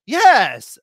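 loud, high eager yes; near shout. A narrator's reading. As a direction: blurt it out almost shouting. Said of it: "I practically shout".

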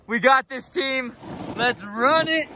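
ordinary talk, nothing marked

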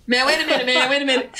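high-pitched comedian impression; Chris Tucker. Amateur comic trying to save himself, high-pitched impersonation. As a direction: squeal it in a panicky comic impression. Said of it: "trying to do a Chris Tucker impersonation".

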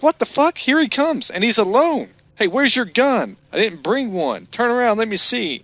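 high, mock-angry ranting; pissed-off mock rant. Quoting Sam Rockwell pissed off at his rival: high mock rant. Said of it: "Sam Rockwell's all pissed".

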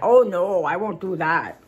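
put-on playful Minnesota accent, opening loud; hammed-up Minnesota voice. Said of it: "I won't do my Minnesota accent".

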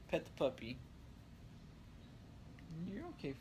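normal talking voice

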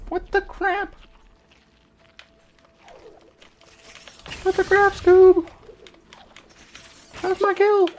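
high, squeaky cartoon dog yelling "Scoob"; high cartoon voice complaining about a stolen kill; Scooby-Doo impression. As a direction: whine like an angry Shaggy-style cartoon. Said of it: "Scooby-Doo impression".

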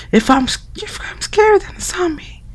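put-on high, hushed, whispered girly voice; girl voice whispering. Friend whispering spooky morgue facts through the door.

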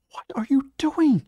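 very high-pitched startled question; high, urgent character voice; alarmed companion. A narrator's reading. Clara alarmed as narrator stands.

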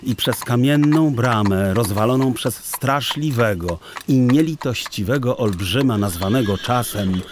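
ordinary delivery, nothing marked

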